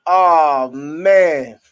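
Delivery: loud, drawn-out oh man, cracking up. Real, not acted. Streamer losing it over a chat joke, loud exclamation. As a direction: belt out the disbelief at the joke.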